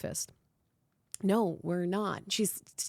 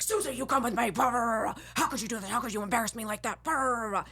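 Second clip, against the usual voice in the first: mock berating; 4 semitones higher, 3 dB louder, faster than usual. Acting out producer berating her.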